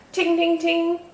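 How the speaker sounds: high, ringing "ting, ting, ting"; imitated toy noise. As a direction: imitate tinkling toys on one high note. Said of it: "They made a lot of noise".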